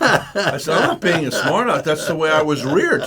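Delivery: laughing through the retold words. Real, not acted. Retelling a traffic stop to friends, laughing. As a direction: chuckle as you tell the punchline.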